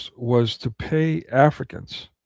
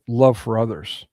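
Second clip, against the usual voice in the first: ordinary delivery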